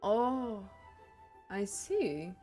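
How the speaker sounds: usual speaking voice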